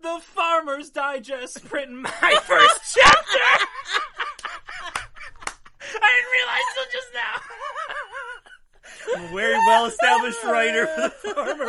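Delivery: laughing shout. Real, not acted. Cracks up reading lyric.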